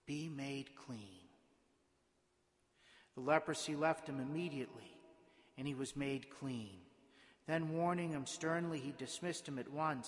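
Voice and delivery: measured, solemn proclamation from the ambo; formal church reading voice; liturgical Gospel reading. Acted. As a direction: read scripture aloud to the congregation, pausing between phrases.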